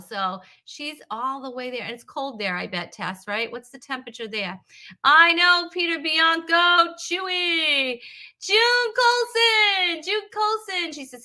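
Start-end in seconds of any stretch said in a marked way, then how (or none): 5.0-10.9 very high, loud, drawn-out name-calling; excited shout-out. Real, not acted. Host hails viewers as they arrive, excited. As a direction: whoop out each name as it appears.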